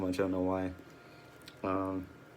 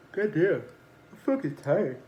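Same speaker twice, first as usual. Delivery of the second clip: tired outburst; 9 semitones higher, 5 dB louder than usual. Real, not acted. Sudden outburst at own tiredness.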